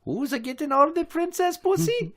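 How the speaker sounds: high Italian plumber voice; Mario accent. Acted. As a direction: ham up the Mario voice. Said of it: "picture Mario having that accent".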